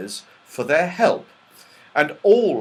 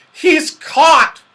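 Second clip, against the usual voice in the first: loud, high cry of triumph; joyful; 12 dB louder than usual. A narrator's reading. Moonface after locking the door, joyful. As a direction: announce the capture joyfully. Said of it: "said Moonface joyfully".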